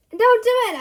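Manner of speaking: high, very loud warning; urgent shout. Acted. Narrator yelling at Alice not to wish, high and loud. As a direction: bark it out to stop her. Stopping another lollipop wish.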